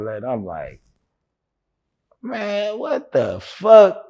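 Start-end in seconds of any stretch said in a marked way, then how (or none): 2.2-4.0 high, drawn-out disbelief; retold reeling exclamation, pitched way up; retold stunned disbelief. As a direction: stretch it out slowly in high-pitched shock. Retold shock at the news.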